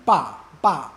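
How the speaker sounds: forceful falling-tone "ba", repeated; fourth tone. Shown as a demonstration. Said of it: "first ba is fourth tone".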